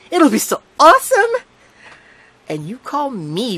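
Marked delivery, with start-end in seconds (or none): 0.1-1.3 high, loud, giddy squeal; sugar-high excitement. A narrator's reading. Girl squealing at narrator on a sugar high, high and loud. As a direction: bounce off the walls with joy. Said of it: "human bobblehead figure on way too much sugar".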